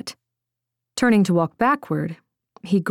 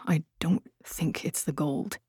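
whispering; 9 dB quieter than usual. A narrator's reading. Secret talk about a traitor.

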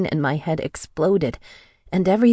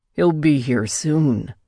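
slow ominous promise; slower than usual. A narrator's reading. Captor warning his captive, slow and ominous. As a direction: let it hang ominously.

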